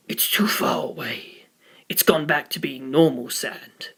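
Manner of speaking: slow, soft unvoiced reply; slow whisper. Astronaut quietly admitting he lost the sand. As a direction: whisper it slowly.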